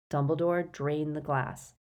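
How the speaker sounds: ordinary delivery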